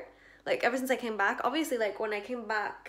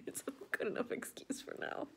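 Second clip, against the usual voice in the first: giggly whisper; 3 semitones higher, 8 dB quieter than usual. Real, not acted.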